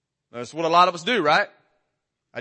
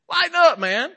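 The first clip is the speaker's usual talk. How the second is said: mock congregation; 11 semitones higher, 4 dB louder than usual. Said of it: "Y'all are over here like".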